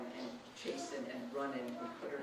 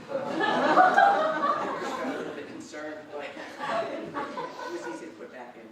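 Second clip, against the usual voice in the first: loud laughter bursting through the story; can't hold the laugh in, slow and giggling; real laughter while telling about the chair; laughing through words; 2 semitones higher, 14 dB louder, slower than usual. Real, not acted. Laughing while retelling funny mishap.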